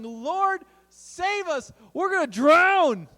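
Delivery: high, desperate shouted plea, last word loudest; shouting for help. Acted. Disciples waking Jesus in the storm, shouting. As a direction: cry out in alarm, building to a shout. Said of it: "woke him up shouting".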